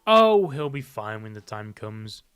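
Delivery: slow, low and loud first try at the line; slow heavy read. Acted. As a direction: drag the line out, low and deliberate. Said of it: "Did I skip a page?".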